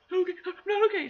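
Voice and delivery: high, gasping mock panic; imitated freak-out. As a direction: imitate her hyperventilating through the words. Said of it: "then she started freaking out".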